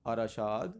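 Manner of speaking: ارشاد as "a-ra-shaad", extra vowel after r; pronunciation option. Shown as a demonstration. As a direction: say ارشاد in three syllables, "a-ra-shaad". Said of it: "اس کا کیا بنے گا".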